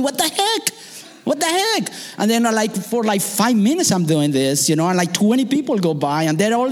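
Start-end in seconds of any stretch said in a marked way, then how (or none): 0.0-1.9 high, baffled cry of what the heck; exasperated disbelief. Retold or imitated. Lost tourist ignored by passersby, high exasperated cry. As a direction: throw up your hands in disbelief. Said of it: "And I'm going".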